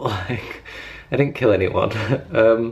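usual speaking voice

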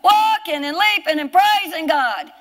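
high, impassioned pulpit cry; full-force preaching. Real, not acted. Preacher marvelling to congregation that the lame man leaped, impassioned shout. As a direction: proclaim it in long, hammered phrases.

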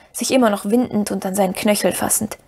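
usual speaking voice

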